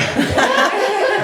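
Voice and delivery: high, loud laugh; burst of laughter. Real, not acted. Bandleader at memorial, helpless laugh at a memory. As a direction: break into a squealing laugh.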